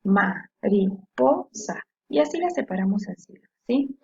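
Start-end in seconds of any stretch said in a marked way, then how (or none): 0.0-1.8 MARIPOSA very slow, cut into separate syllables; split into syllables. Shown as a demonstration. Teacher showing pupils the cuts in a word, Mariposa syllable by syllable. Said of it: "hacemos un corte en cada una".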